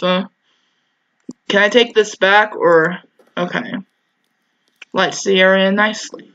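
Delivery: ordinary delivery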